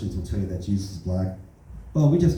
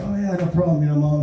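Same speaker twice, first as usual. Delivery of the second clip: higher, mock-casual reassurance; placating son; 6 semitones higher, 4 dB louder than usual. Son brushing off his crying mom, mock-casual reply. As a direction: toss it off brightly.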